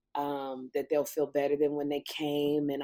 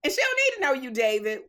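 high, sharp, louder brush-off; sassy put-down; 4 dB louder than usual. Retold or imitated. Retelling how she shut David down, high and sassy. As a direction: put him firmly in his place. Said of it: "I was like".

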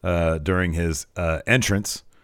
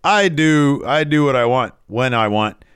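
raised, punchy mock wrestling promo; Waller; 6 semitones higher, 5 dB louder than usual. Waller claiming NXT as his own, boastful. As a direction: boast like a cocky heel. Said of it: "he says".

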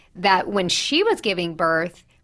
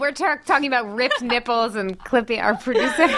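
laughing. Real, not acted. Both hosts break into laughter.

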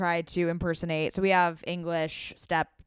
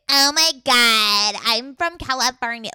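slow, higher mock Valley Girl drawl; hammed-up Californian Valley Girl; 3 semitones higher, 5 dB louder, slower than usual. Mocking how step-siblings fake an American accent, slow and higher. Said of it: "this is their American accent".

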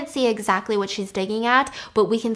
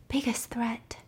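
whispering; 9 dB quieter, slower than usual. Retold or imitated. Dad's ominous warning, whispered.

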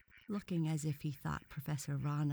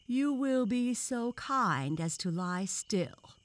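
slow, loud, high-pitched severity; severe; 7 semitones higher, 7 dB louder, slower than usual. A narrator's reading. Professor orders the specimen to lie still, severely. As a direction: order it sternly, drawing out the words. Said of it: "said the professor severely".

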